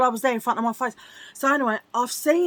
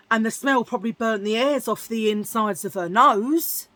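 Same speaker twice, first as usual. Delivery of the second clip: normal talking voice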